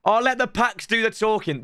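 normal talking voice

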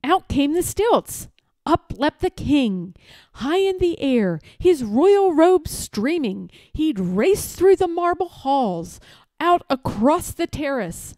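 ordinary delivery

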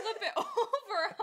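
laughing through the words, slow. Real, not acted. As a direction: giggle mid-sentence.

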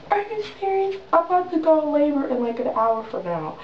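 high-pitched retold disbelief; freaking out. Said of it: "So I'm freaking out".

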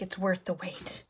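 whispered quote. Retold or imitated. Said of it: "They're like".

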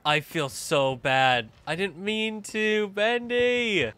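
guilty wail, stretched out; remorseful whine. Real, not acted. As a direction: whine an apology to a hurt pet. Remorse after making Bendy cry.

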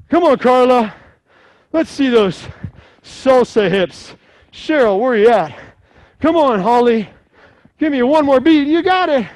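high, loud encouragement; excited cheering. Real, not acted. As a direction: cheer each one on. Cheering on named class members.

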